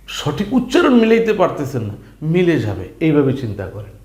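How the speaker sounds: ordinary delivery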